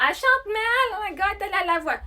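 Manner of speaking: high-pitched mock outcry from detractors; imitating online critics. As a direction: mimic critics exclaiming, pitched well up. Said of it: "Ils vont". (imitation aiguë des critiques indignés)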